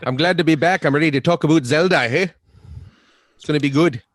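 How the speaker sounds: mock Canadian accent. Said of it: "I picked up a Canadian accent".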